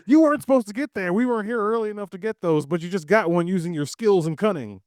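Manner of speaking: high, animated rush of words; animated pretend case-making; mock justifying. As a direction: argue it up with playful indignation. Mock justifying friend's snatched prize.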